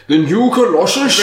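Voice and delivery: high, hammed-up questioning wizard; put-on wizard voice. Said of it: "you do the kinda".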